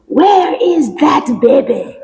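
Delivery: put-on slow, high Caribbean accent. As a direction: demand it slowly in a Caribbean accent. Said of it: "in the Caribbean accent".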